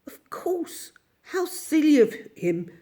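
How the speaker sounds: breathy whisper. A narrator's reading. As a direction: whisper it to yourself. Michael realising, inner thought.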